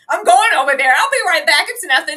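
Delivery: hammed-up carefree wave-off; mock independent woman. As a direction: play the heroine who insists she'll be fine.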